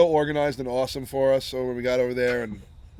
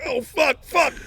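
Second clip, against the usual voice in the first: high mock-panicked cursing; high-pitched imitated alarm; retold panic; 12 semitones higher, 4 dB louder, much slower than usual. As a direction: curse in rising dread, acted out. Retold panic, cigar can't be found.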